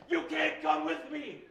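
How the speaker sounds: loud bar voice, calling out; shouting across bar. Acted. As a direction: yell it across a noisy bar.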